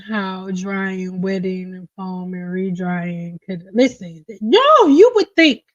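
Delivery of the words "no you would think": loud and higher exasperated no. Real, not acted. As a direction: burst out in disbelief. Exasperated outburst at lazy stylists.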